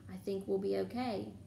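plain reading voice

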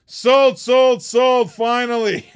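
loud, high sold called out three times; auctioneer's shout. Real, not acted. Auctioneer closing the sale to slow bidders, loud triumphant shout. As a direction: bang the gavel with your voice, relieved.

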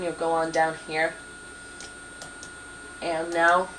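ordinary delivery

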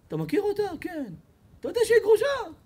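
high, nosy friend's voice; pry eagerly about her; high pitched gossiping friend. Retold or imitated.

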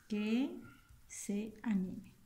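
"Que", long pause, then slow "se anime"; dictating while writing. Shown as a demonstration. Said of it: "vamos a escribir una oración".

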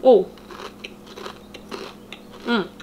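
chewing while speaking; mouth full of chip. Real, not acted. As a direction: lips pursed around food. Seen 2: Chip put in mouth at -3s; cheeks puffed, lips pursed chewing throughout.

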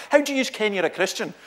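loud, high challenge in broad Scots; Scots accent. Retold or imitated. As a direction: fire the question in a Scots accent like a heckler. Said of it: "so I'll do it in Scots first".

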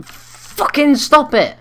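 very high, slow, loud shriek; shrieked rage. Real, not acted. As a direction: shriek it out in fury. Interrupted by being killed.

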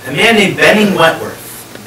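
normal talking voice

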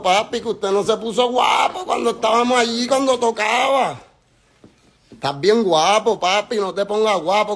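high, scornful yell at his rival; taunting shout. Real, not acted. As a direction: shout him down, mocking. Taunting rival in a diss rant. (grito burlón)

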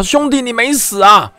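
amazed. Acted. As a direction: drag it out in disbelief. Brother sees him alive. (饭桶惊讶地叫兄弟)

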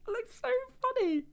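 high, squeaky words through laughter; helpless laughter. Real, not acted. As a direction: barely get it out for laughing. Laughing at featherless owl.

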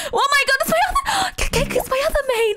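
very high, fast, gasping outburst; panicked squeal. Real, not acted. Player realizing it's their other main, in a panicked squeal. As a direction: stammer and gasp in a high-pitched rush.

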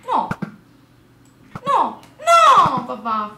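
loud high despairing no; shouting. Real, not acted. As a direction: wail it in despair. In-game loss outburst.

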